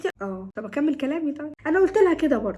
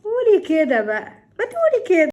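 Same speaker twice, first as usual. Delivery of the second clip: knowing exclamation; 6 semitones higher, 7 dB louder than usual. Acted. Mother finally getting the point, knowing exclamation. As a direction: burst out loudly as it clicks.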